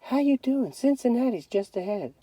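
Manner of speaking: soft and high, hushed; wife quietly checking on her tired husband. A narrator's reading. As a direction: speak softly so the kids sleep. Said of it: "Mama say real soft to Dad".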